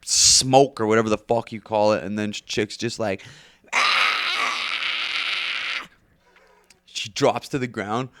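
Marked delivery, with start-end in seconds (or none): 3.7-5.9 a sharp, high, breathy mock shriek; a high, airy scream, only imitated; retold scream. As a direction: imitate her pained yelp. Girl maced, drops to ground.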